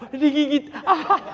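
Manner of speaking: high, loud complaining reply; mock pained mother. Mother tells husband she was bitten, high and loud. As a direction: whine that the baby bit her.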